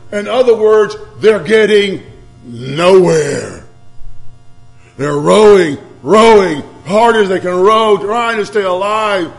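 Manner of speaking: emphatic preacher, loud bursts on key words; driving sermon delivery; forceful preaching. Real, not acted. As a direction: preach it with rising urgency, stretching the big words. Preaches the rowing story forcefully.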